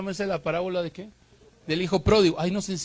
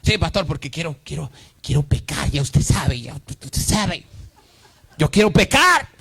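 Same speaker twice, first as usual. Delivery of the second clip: whiny sinner; 4 dB louder than usual. Retold or imitated. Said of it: "Me coge la gente".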